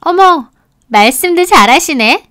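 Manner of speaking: high, coquettish lilt; delighted flirty friend. Acted. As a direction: react to flattery with a high, pleased swoop.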